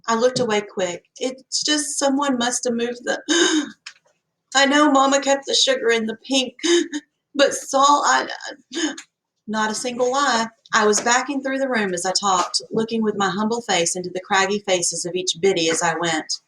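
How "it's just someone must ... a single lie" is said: high, gasping put-on tears; fake sobbing. A narrator's reading. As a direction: gasp through fake tears. Girl fakes sobbing gasps.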